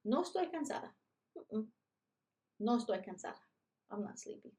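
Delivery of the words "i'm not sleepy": breathy whisper, not voiced; whispered aside. Shown as a demonstration. Teacher whispering a quick aside to the kids. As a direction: lean in and whisper it.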